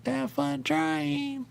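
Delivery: high, very slow mocking drawl. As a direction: draw it out sarcastically, way up high. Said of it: "They go".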